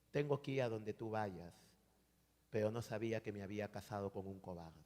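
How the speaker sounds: low, soft retold reproach; quiet rebuke. Wife answers her fed-up husband, retold as quiet rebuke. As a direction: drop the voice for a wounding remark.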